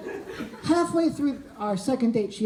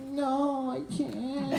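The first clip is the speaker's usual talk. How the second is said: timid refusal; 9 dB quieter, much slower than usual. Retold or imitated. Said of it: "I opened my mouth to be like".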